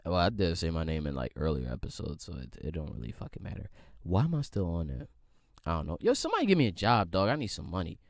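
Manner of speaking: low, soft sheepish aside; embarrassed murmur after the slip; quiet aside. Real, not acted. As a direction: drop it low, half to yourself. Muttering aside after saying his name.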